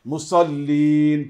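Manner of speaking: মুসাল্লিন drawn out with a thick sad; slow model for the class; heavy sad, slow. Shown as a demonstration. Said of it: "সাদ দিয়ে।".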